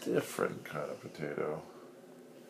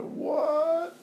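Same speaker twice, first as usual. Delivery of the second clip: squeaky baffled what; high exasperated what; 10 dB louder than usual. Real, not acted. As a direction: squeak it out in disbelief. Father's squeaky exasperated outburst.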